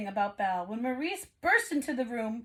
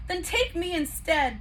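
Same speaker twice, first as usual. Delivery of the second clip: demanding; 7 semitones higher, 4 dB louder than usual. A narrator's reading. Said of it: "Belle demanded".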